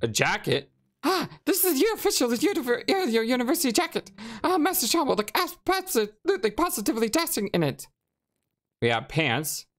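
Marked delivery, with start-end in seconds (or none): 1.0-7.9 high, stammering rat shopkeeper. Acted. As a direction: squeak it out like a nervous rat. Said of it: "rat voice".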